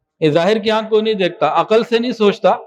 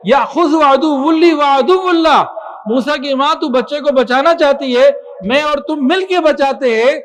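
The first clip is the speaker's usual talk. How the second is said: preaching at full force; 5 semitones higher than usual. Acted. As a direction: drive the words home to the crowd. Preaching peak, raised voice.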